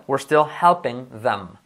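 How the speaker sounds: "still" with a clear L, said slowly; slow, lean on the L in "still"; slow drill. Shown as a demonstration. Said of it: "la L". ("still" lento, marcando la L)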